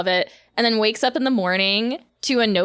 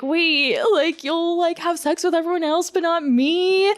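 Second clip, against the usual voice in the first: hurt whine; 7 semitones higher than usual. Retold or imitated. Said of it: "she's like".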